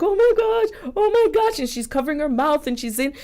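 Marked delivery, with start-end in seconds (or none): 0.0-1.6 imitating overwhelmed crying, pitched way up; mock tearful squeal at a proposal; high, put-on happy weeping; retold tearful gasp. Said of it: "She starts crying and she's like".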